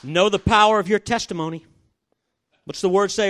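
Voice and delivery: loud, driving sermon voice; full-force preaching. Acted. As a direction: preach it out to the back row.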